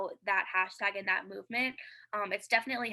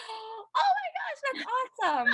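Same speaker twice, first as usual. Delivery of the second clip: mom's amazed reaction; 13 semitones higher than usual. Retold or imitated. Mom reacting to the video.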